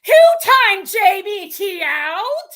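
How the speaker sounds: high, loud, slowly drawn-out mocking quote; mimicked outburst. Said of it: "She's like".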